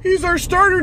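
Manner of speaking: sarcastic imitation of the GM welcoming him back; mocking Howie Roseman. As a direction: parody a smug, falsely gracious executive. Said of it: "Howie Roseman in his press conference is like".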